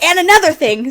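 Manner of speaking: high, loud scolding retort; loud nagging "and another thing"; mock angry wife. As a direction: snap it like a mad wife. Acted angry woman.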